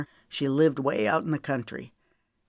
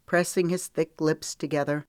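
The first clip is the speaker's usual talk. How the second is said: usual speaking voice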